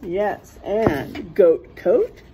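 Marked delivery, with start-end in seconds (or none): none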